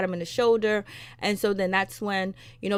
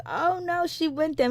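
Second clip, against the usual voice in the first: shocked gasp; 8 semitones higher than usual. Retold or imitated. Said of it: "I was like".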